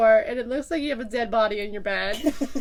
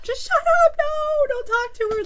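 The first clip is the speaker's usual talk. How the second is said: squeaky urgent hush; 4 dB louder than usual. Retold or imitated. Urgent hushing turns squeaky.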